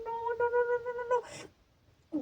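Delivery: high, thin string of no's fading to breath; retold desperate denial. Owner sees the dog's eyes dim, high fading desperate no's. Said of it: "Yo empecé a gritar como loca".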